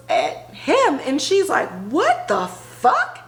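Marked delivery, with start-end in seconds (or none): 1.9-3.2 high, slow drawn-out disbelief; imitating a shocked young woman; stunned. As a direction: let it hang in shock. Maggie staring in shock.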